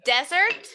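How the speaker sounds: Desert with the first syllable high; stress on the first syllable. Shown as a demonstration. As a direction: punch the DE of Desert, rising. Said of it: "el énfasis está en la primera sílaba".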